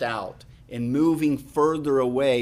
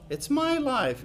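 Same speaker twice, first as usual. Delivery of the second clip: 1 mocking selfish voice; 10 semitones higher than usual.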